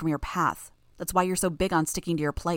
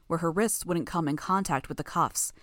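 plain reading voice

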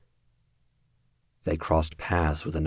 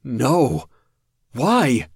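loud, much higher cry of disbelief; louder despair; 8 semitones higher, 8 dB louder than usual. A narrator's reading. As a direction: cry out at the hopeless sight. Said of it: "Louder, he repeated himself".

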